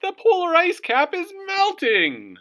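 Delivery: very high, very loud shout; cartoon fox shouting. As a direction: bellow the alarm. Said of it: "shouted Foxy Loxy".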